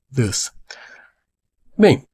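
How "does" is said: "does" said short "dâs", low and soft; correct pronunciation. Shown as a demonstration. Teacher settling the right sound of "does", "dâs". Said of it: "é".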